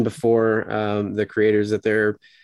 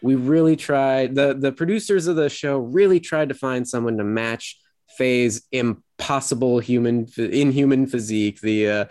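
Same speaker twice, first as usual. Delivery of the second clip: dripping with sarcasm; 3 semitones higher than usual. Retold or imitated. Host retells actress's reply to snarky critics, dripping with sarcasm. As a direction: imitate a fake heartfelt apology, stretching the key words. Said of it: "just dripping with sarcasm".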